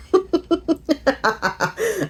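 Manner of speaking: high-pitched delighted giggle; giddy high laugh. Real, not acted. Laughing at herself.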